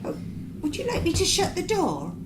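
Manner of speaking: usual speaking voice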